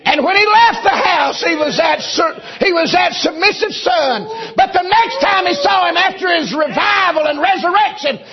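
very high, loud, slow whooping cry; preaching at full force. Acted. Preacher at the climax of the Joseph sermon, slow high shout. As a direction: hold each word and ring it out.

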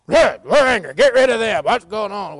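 loud, hammed-up indignant outburst; mock angry disciples. Preacher playing disciples turning away children, mock angry shout. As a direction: bluster loudly, playing it for laughs. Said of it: "and the disciples".

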